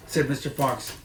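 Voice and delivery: plain reading voice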